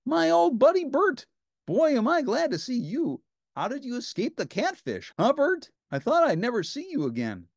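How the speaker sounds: very high, loud joyful cry; Ernie crying out. A narrator's reading. Ernie seeing Bert is safe, high joyful shout.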